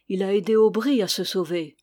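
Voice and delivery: ordinary delivery, nothing marked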